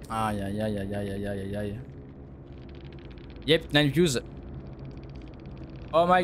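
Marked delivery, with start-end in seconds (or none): 0.1-1.8 low, soft mocking aïe aïe aïe; mock pity. Streamer mocks beaten opponent with soft aïe aïe. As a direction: fake sympathy, lower and softer.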